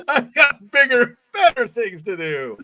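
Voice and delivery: high, loud mock proclamation; over-the-top, high-pitched declaration; hammed-up boast. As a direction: crow it in mock self-importance. Hammed-up repeat of the quote, joking.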